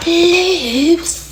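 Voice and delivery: high rising teasing loser; mocking loser taunt. Toddler winning the race to bed, high gloating taunt. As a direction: sing it out like a gloating kid.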